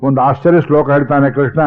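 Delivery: ordinary talk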